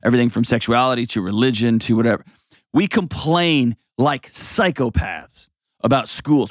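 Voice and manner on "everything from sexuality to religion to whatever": ordinary talk, nothing marked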